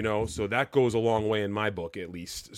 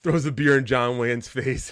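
ordinary talk

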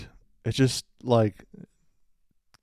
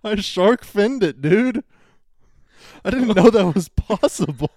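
high, loud amazed telling; excited; 9 semitones higher, 8 dB louder than usual. Real, not acted. Excited poop story climax.

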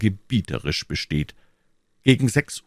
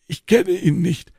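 put-on old man; 10 semitones higher, 3 dB louder than usual. Old man answers D'Artagnan.

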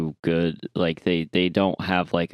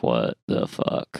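slow, hushed, low mutter of disbelief; slow, under-the-breath bafflement; baffled mutter; 10 semitones lower, slower than usual. Acted. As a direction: trail down into a low, unvoiced mumble. Reacting to later seasons, puzzled.

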